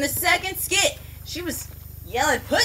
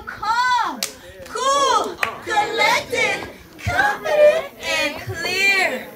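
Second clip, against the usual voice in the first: rising louder and higher; emphatic catchphrase; 8 semitones higher, 6 dB louder, much slower than usual. Real, not acted. Teacher stressing her catchphrase to the class, higher and louder. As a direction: build the four words to a peak. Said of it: "teacher's rising catchphrase".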